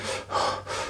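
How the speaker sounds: imitated huffing and puffing; heavy winded breaths, acted out; hard breathing. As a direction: puff hard as if after a sprint. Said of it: "breathing so hard".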